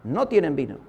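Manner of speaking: low, falling quote of Mary; knowing repeat. Retold or imitated. Preacher repeats the mother's words to the congregation, low and knowing. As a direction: drop it low, as an understood hint. Same hint repeated as understood.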